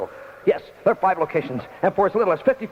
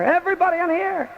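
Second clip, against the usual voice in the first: high visitor voice; 12 semitones higher than usual. Acted.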